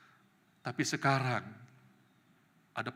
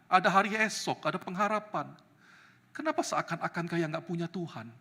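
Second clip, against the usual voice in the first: normal talking voice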